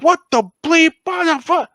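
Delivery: high, loud startled yell; mock outraged shout; retold yelling. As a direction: yell it at the car that hit you. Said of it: "I just start yelling".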